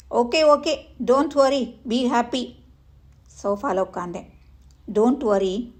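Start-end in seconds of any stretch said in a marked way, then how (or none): 0.1-2.5 breezy catchphrase. Acted. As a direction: toss off the motto cheerfully, higher. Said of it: "Don't worry, be happy ... என் வாழ்வின் மந்திரம்".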